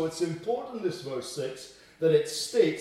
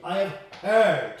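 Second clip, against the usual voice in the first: "heard" hit louder and falling; emphasis on heard; 6 dB louder than usual. Shown as a demonstration. Preacher listing what God does, stressing heard. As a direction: lean hard on "heard". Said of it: "four things there you recognize".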